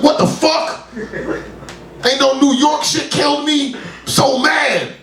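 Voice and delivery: high, incredulous indignation; fuming about a boring New York death; mock outrage. Outraged at dying of old age.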